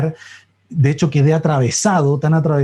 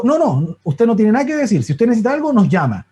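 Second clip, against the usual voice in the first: higher-pitched, brusque refusal to listen; retold angry nurse; 7 semitones higher than usual. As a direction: imitate a strict nurse cutting the patient off. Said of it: "Bien enojada".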